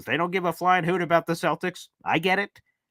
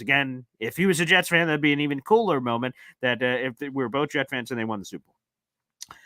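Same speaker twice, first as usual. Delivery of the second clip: ordinary talk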